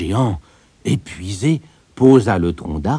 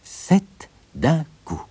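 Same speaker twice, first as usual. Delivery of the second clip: slow, higher-pitched, separate words; 8 semitones higher, 3 dB quieter, much slower than usual. A narrator's reading. Tailor embroiders the words on his belt, word by word. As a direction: spell out the motto slowly, a pause after each word. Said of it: "broda ces mots sur sa ceinture".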